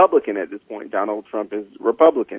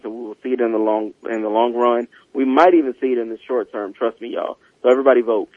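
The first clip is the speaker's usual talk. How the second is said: ordinary talk, nothing marked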